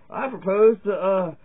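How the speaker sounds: hammed-up earnest citizen pitching chickens; mock town hall speaker. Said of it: "And they were like".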